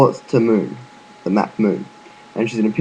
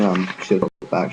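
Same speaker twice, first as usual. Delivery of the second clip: ordinary talk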